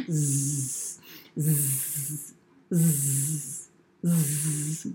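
low buzzing pretend snore; storybook buzzing snores; snoring. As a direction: drone a low, soft zzz of sleep. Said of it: "Amy snored".

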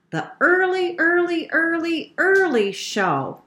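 high storybook repetition, falling at the end; high-pitched repetition. A narrator's reading. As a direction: chant each Early high, then drop on Show.

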